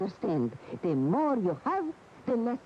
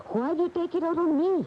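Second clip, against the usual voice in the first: pitched up high, pleading and accusing; hurt accusation; 12 semitones higher than usual. Acted. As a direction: throw the blame back at him, voice rising high. Wife's hurt accusation in quarrel.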